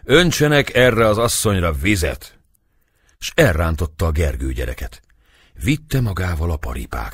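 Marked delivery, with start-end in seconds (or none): 0.0-2.4 higher, louder command; angry order. A narrator's reading. Dobó ordering water thrown on the woman, angry. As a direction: bark the order in irritation. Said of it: "bosszúsan Dobó".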